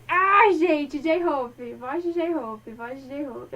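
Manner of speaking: loud fangirl outburst, easing off as it repeats; gushing excitement. Real, not acted. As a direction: burst out loudly, overcome by the idol. Fã exaltada ao anunciar [name]. (empolgação de fã, em voz alta)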